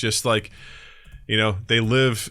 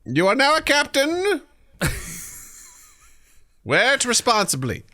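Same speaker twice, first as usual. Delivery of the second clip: herald; 13 semitones higher than usual. Acted. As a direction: proclaim it like a medieval herald. Herald bestows captain title.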